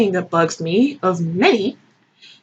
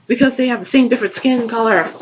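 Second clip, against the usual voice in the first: ordinary delivery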